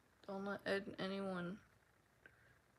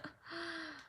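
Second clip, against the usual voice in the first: soft drowsy laugh; tired little laugh; sleepy giggle; 8 semitones higher, 4 dB quieter than usual. Real, not acted.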